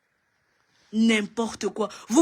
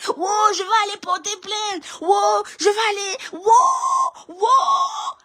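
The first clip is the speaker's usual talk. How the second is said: high, tearful mock wail; mock crying complainer; 9 semitones higher, slower than usual. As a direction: whimper out a fake threat to complain. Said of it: "elles vont crier partout". (imitation pleurnicharde, aiguë et larmoyante)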